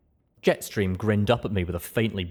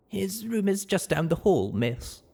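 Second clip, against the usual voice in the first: higher, subdued servant voice; maid character voice; 11 semitones higher than usual. Acted. Maid's smile fades.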